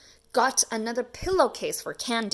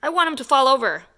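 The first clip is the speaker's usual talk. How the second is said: louder, quick and eager; 4 semitones higher, 7 dB louder, faster than usual. Acted. Lance eager to make his father fall over. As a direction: push for a bigger scare. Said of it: "said Lance".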